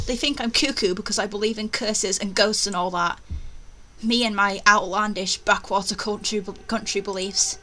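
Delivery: ordinary talk, nothing marked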